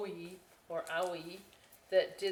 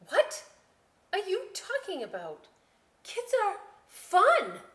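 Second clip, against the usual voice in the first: put-on much higher, louder protesting kid; insist, offended; indignant girl; 14 semitones higher, 8 dB louder than usual.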